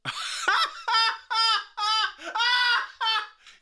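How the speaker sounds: high, loud, helpless laughing fit; crying laughter. Real, not acted. Streamer losing it over a comment, wheezing high laughter. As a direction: laugh till it almost turns to tears.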